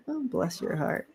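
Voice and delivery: sugary southern put-down, lightly done; southern bless your heart. Retold or imitated. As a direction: coo it like a syrupy southern lady. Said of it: "I can hear it".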